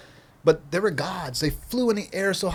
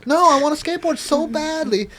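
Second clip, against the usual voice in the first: high, raised-voice declaration; impassioned protest; 11 semitones higher, 5 dB louder than usual. Retold or imitated. Hurt skater insisting on skating, impassioned protest. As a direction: cry out with hammed-up longing. Acting out stubborn passion after injury.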